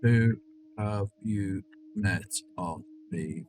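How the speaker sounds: very slow, quiet, word by word; typing aloud. Real, not acted. Streamer types a dating question, slow and halting. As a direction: murmur each word with gaps as you type.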